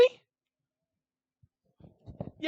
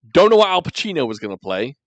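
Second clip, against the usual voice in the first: ordinary delivery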